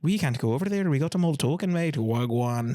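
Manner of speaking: botched Irish accent that drifts to Jamaican by the end; Irish turning Jamaican. Shown as a demonstration. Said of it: "an Irish accent, it ends up Jamaican".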